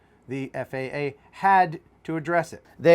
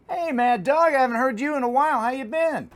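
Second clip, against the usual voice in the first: higher, fast trucker greeting; trucker cb radio; 7 semitones higher than usual. Acted. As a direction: hail a buddy over the CB. CB trucker banter.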